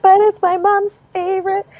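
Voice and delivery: put-on high-pitched childish wail; mock crying. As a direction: imitate a distraught kid protesting. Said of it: "you're crying".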